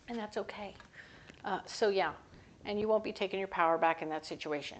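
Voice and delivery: ordinary talk, nothing marked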